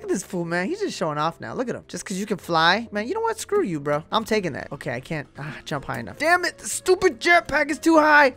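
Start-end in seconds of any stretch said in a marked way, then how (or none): 6.2-8.3 frustrated yell. Real, not acted. Can't reach jetpack.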